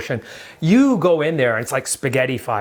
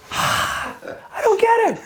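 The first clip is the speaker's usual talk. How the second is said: confused parent. Acted. Host mocking a clueless parent, high baffled voice. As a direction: play a baffled parent who doesn't get the meme.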